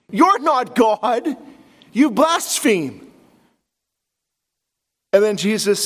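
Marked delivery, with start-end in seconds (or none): 0.1-3.1 mock scribe accusation.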